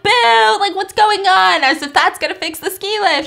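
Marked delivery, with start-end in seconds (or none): 0.0-1.6 mock booing crowd. Crowd booing stuck lift.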